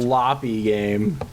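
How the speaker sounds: slow drawn out. Acted. Drawn-out joke repetition.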